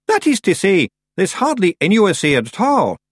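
high, loud Scots pixie voice; Scottish Feegle. A narrator's reading. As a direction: pipe up as an excitable little Scottish creature.

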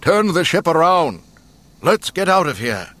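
high, loud alarmed shout; urgent shouting. A narrator's reading. Usually calm Kenobi ordering retreat, shouting. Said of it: "usually calm Kenobi was shouting".

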